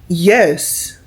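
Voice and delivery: ordinary delivery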